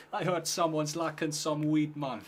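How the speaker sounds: mock cartoon hero announcing himself; cartoon character. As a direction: play a hint of a cartoon stoner. Voicing an imagined cartoon character.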